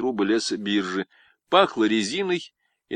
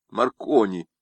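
ordinary delivery

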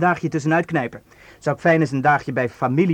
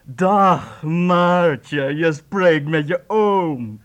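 slow, put-on uncle voice, a bit louder; fake uncle voice; 4 dB louder, much slower than usual. [name] posing as uncle to Maartje on the phone, slow put-on voice. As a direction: drag out each word like a jovial old uncle. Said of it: "ik zeg dat ik een oom ben".

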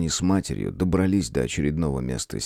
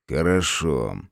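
plain reading voice

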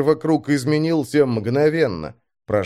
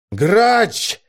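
high, forceful shout; fierce bark; 9 semitones higher, 4 dB louder than usual. A narrator's reading. Commander pounding the glass at his driver, barking. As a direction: bark the name like an order. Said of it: "рявкнул мрак, ударив кулаком".

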